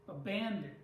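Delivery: "Abandon" with BAN punched; light "a", then weight on the "ban" of "Abandon"; stress on second syllable. Shown as a demonstration. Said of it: "the second syllable".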